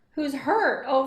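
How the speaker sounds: ordinary talk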